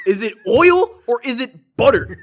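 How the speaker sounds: loud, high, slow and emphatic; hammed-up indignant question; exasperated questioning. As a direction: demand an answer at the end of your patience. Same question repeated in exasperation.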